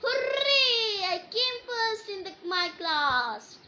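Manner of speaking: high, loud triumphant hooray; joyful cheer. Shown as a demonstration. Teacher showing how to read a happy exclamation, joyful. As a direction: cheer it like you just won. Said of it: "So, how you read?".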